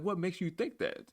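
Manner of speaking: high, coy voice; mock innocent question. Teasing the toxic gamer on the headset, high mock-innocent voice. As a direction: play dumb with a sweet, high question. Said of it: "I was like".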